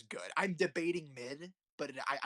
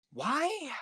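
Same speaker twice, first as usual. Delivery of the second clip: a sudden, much higher, louder why; exasperated why; 13 semitones higher, 6 dB louder than usual. Real, not acted. Reviewer asking viewers why, exasperated and high. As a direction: throw the question up in disbelief.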